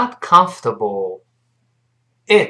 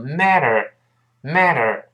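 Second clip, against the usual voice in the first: American MATTER; much slower than usual. Shown as a demonstration. Teacher showing the American reading, "matter" with voiced t and r. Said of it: "中间这个T读快之后会轻轻浊化一下".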